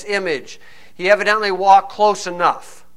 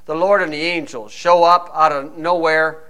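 usual speaking voice

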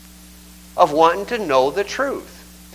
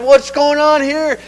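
puzzled; 7 semitones higher, slower than usual. Retold or imitated. Peter puzzled in retold scene.

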